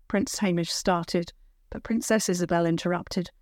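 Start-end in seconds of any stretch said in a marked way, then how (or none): none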